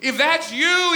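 high, hollered challenge; Peter yelling. Retold or imitated. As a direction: call it out across distance. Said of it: "he hollers".

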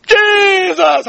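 high, drawn-out cry of wonder; awestruck cry. Retold or imitated. John crying out as his arm straightens, awestruck.

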